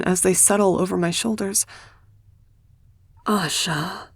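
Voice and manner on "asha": soft, quiet, tender call of her name; teacher softly calling the broken girl back. Acted. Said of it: "the soft voice of my former teacher".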